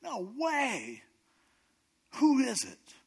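high-pitched outraged disbelief; acted royal fury, voice leaping high; furious king. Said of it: "the king's just furious".

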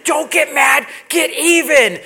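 high, loud echo of mom's yell; remembered yell. Retold or imitated. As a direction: call it out like the voice in your head. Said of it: "I still hear it".

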